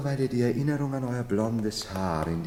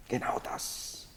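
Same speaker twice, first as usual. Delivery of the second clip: whisper; 11 dB quieter, slower than usual. Acted. Sly confidential aside to lady.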